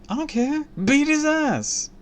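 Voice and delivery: cartoonish insistence; over-the-top demand. Real, not acted. Reader urges violence again.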